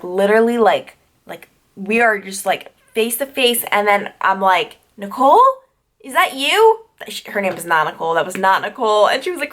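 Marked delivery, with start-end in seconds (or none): 5.0-6.9 high, rising mock-confused question; tentative questioning. Sleepwalker face to face with roommate, high questioning lilt. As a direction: imitate a confused sleepwalker asking. Peering at roommate without glasses, retold.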